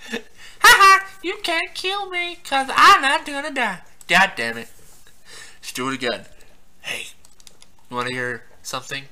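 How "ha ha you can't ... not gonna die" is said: high, singsong gloating; mock from up in a high put-on voice; taunting character voice. Character taunts player "you can't kill me", high gloating.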